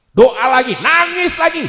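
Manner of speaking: loud, high preaching shout; fiery urging to keep praying; urgent exhortation. Real, not acted. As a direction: drive the list home forcefully. Urging crowd at full force.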